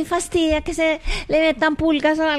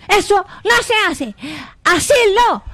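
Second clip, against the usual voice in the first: loud, raised scolding of a dog; 3 semitones higher, 5 dB louder than usual. Shown as a demonstration. Child demonstrates telling off a dog, scolding. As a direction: tell the dog off firmly. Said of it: "si tú lo regañas".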